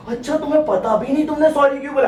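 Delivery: high-pitched indignant girlfriend imitation; hammed-up high, incredulous accusation; mock outrage. Girlfriend outraged he doesn't know.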